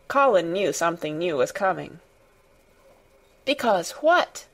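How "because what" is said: eager, higher-pitched question. A narrator's reading. Boy sensing something new is coming, eagerly. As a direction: ask it eagerly, pitch lifted. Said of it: "he cried eagerly".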